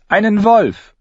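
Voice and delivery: high, loud, emphatic announcement; alarmed girlish certainty; insistent. A narrator's reading. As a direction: insist on it to disbelievers. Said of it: "beharrte sie".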